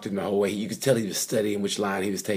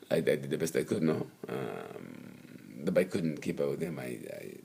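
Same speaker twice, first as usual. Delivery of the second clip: much lower, much softer sulky mumble; sulking Lorenzo; 8 semitones lower, 11 dB quieter than usual. Retold or imitated. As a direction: sulk through the excuses in a low murmur. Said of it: "like he was sulking in a way".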